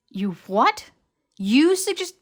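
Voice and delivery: loud, high disbelief; shocked outburst. Acted.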